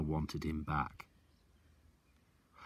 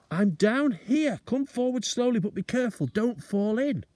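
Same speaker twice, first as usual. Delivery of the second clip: father calling up; 7 dB louder than usual. Acted. Father warning son from pit.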